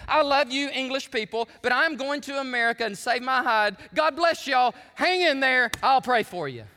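high, loud joke version of a statesman's speech; mock Churchill farewell. Leader abandoning the English people for America, mock rousing farewell. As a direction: send up a leader calling goodbye to his people. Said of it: "That's not what he said".

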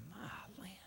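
breathless whispered awe; preacher awed by the thought of heaven, whispered. Real, not acted. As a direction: breathe it out in wonder. Awed exclamation in sermon.

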